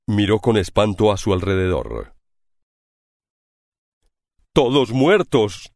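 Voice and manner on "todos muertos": slow, anguished exclamation; a slow cry of anguish over the dead. A narrator's reading. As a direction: let the horror drag the words out. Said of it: "exclamó con angustia".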